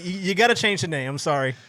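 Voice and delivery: normal talking voice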